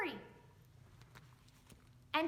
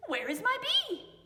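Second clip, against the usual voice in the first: higher and louder, demanding; indignant demand; 9 semitones higher, 7 dB louder than usual. Acted. Foxy confronting the woman about his bee, loud demand. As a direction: call out as if robbed. Foxy finds empty sack.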